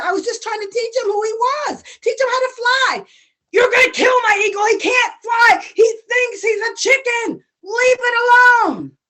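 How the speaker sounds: high, loud, pleading anger; upset scolding. Acted. Farmer scolding brother.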